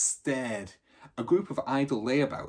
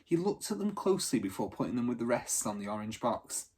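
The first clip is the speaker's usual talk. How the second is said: ordinary delivery, nothing marked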